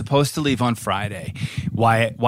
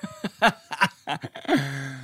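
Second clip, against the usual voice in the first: laughing; 5 semitones higher, 4 dB quieter than usual. Real, not acted. Laughing after singing.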